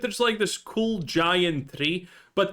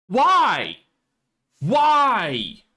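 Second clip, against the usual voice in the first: exasperated; 4 semitones higher, 6 dB louder than usual. Real, not acted. Frustrated rant about quests.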